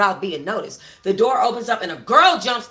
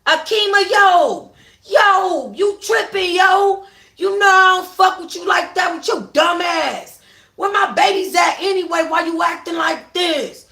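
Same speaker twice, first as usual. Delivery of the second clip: high, loud pleading shout; exasperated shout; 8 semitones higher, 6 dB louder than usual. Acted. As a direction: shout her down from the porch. Charles shouting back at Akima.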